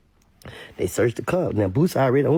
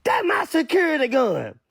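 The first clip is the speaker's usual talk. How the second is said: high, put-on voice for [name]'s line; mock [name], pitched well up; 12 semitones higher than usual. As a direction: squeak it out like a scared rapper. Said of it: "told twelve".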